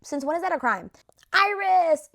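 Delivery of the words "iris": loud excited call out. Real, not acted. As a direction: announce her name, thrilled. Announcing favorite islander.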